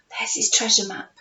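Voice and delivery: ordinary delivery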